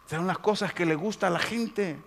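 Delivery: ordinary talk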